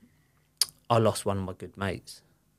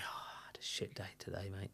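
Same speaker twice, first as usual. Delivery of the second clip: unvoiced, very soft confession; quiet whisper; 19 dB quieter than usual. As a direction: whisper it like a tired admission. Dad confiding, retold.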